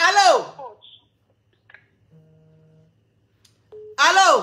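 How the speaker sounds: shouting hello into a bad phone line; calling out hello. Real, not acted. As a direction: call out to check the caller is there. Host calling into crackling phone.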